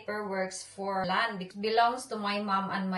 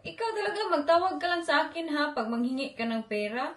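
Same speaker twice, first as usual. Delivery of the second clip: teasing scold; 5 semitones higher, faster than usual. Retold or imitated. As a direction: tease him sharply, pitched way up. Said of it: "Diretso ko daw siya prinangkahan". (mataas at mabilis na pabirong pagsermon)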